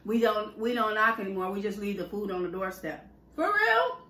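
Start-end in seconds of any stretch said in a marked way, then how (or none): 3.4-4.0 high incredulous question; can't believe it; outraged disbelief. Real, not acted. Outrage at delivery.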